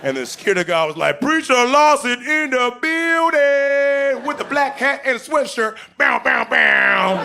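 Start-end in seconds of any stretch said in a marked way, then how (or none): 1.2-7.2 high, slow, loud announcer call; announcer hype. Retold or imitated. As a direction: hype him up like a ring announcer. Guard announces him like a hype man.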